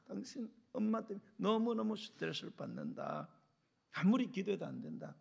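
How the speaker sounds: a touch of wifely exasperation; complaining wife. Acted. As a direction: air a wife's mild grievance to her husband. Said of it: "남편한테 이야기하는 거죠".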